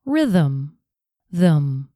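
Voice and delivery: "Rhythm" with a small "uh" before the m; schwa demo. Shown as a demonstration. Teacher modelling the linking schwa for learners. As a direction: link "th" and "m" with a weak schwa. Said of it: "It sounds like this".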